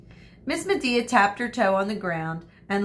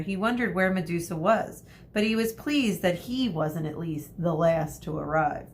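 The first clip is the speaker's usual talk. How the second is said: plain reading voice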